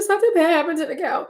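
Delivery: high, teary retold wail; crying. Telling her sister about the cow while crying. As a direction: wail it through tears. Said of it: "in complete tears".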